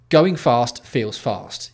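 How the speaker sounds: slow emphatic explanation; slow emphatic point. Real, not acted. Spelling out a point slowly.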